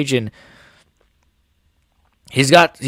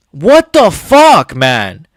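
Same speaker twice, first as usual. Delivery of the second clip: exasperated outburst; 5 dB louder, much slower than usual. Real, not acted. Fed up with the matchmakers, a slow exasperated cry. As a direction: let the outrage stretch out slowly.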